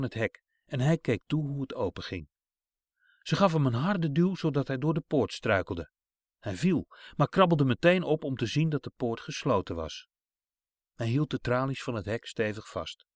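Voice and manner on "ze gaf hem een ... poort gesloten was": plain reading voice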